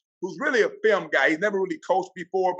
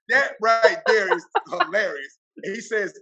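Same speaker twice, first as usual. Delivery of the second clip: usual speaking voice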